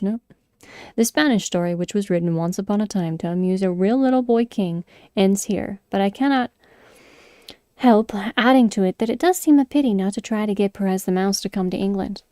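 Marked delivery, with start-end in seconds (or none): none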